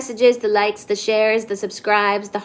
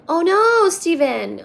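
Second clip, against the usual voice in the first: dismayed reaction; 6 semitones higher than usual. Real, not acted. Streamer reacting to Steven's message, dismayed. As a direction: cry out in dismay, then drop.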